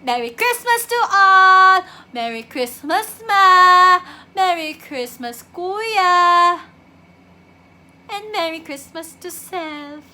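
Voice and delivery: loud, drawn-out holiday cheer; calling out greetings. Acted. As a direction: shout greetings to absent family, stretching the last word. Calling greetings to absent family. (pasigaw na pagbati sa pamilya)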